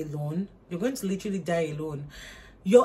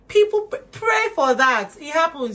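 loud urging; 8 semitones higher, 8 dB louder than usual. Real, not acted. Urging viewers to pray for it, loud preaching. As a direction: call it out like a preacher.

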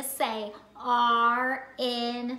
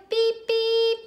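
high mock horn "Beep, beep"; playful car beep; 10 semitones higher, 6 dB louder than usual. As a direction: toot it like a toy car. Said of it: "What does a car do?".

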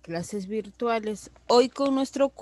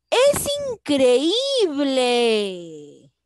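drawn-out, louder cry of amazement; amazed exclamation; 2 semitones higher, 7 dB louder, much slower than usual. Shown as a demonstration. Teacher reading an example sentence to pupils, amazed and drawn out. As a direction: say it slowly with wide-eyed wonder.

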